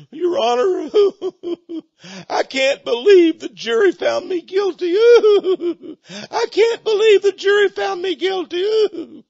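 high wailing fake cry, stretched out slow; mock sobbing. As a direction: whine it like a caught killer crying for pity. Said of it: "Oh, he cried".

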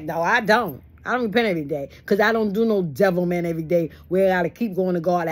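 ordinary delivery

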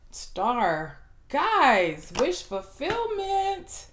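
slow, stretched, rising to a high squeal on wish fulfillment; drawn-out excited emphasis. Real, not acted. Tarot reader thrilling her viewers with the Star card, slow and rising high. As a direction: savour each word like a dream coming true.

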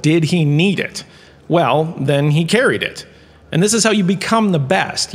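ordinary talk, nothing marked

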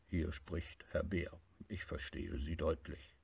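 a dignified bear on the phone. Acted.

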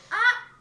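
sudden loud yelp; startled scream. Real, not acted. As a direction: cry out when hit. Killed in game. (grito de sobresalto)

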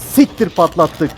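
sudden loud high curse; startled outburst. Real, not acted. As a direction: blurt it out in shock. Generator blown, sudden outburst.

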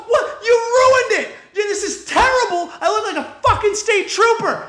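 high-pitched, indignant rant; mad outburst. Retold or imitated. Customer telling the barber he ruined the haircut, mad outburst. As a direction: protest in a high, appalled voice. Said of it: "I was so mad".

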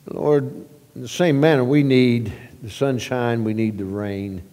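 ordinary talk

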